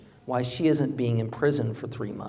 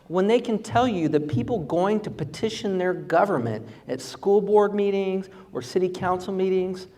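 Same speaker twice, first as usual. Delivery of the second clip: fiery stump speech; 4 semitones higher than usual. Acted. Candidate rallying the crowd against government overreach, fiery oratory. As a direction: hammer each grievance home to the crowd.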